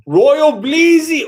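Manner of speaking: stretched, high and loud shout of the name; hyped greeting; excited call-out. Real, not acted. Reading a member's name aloud.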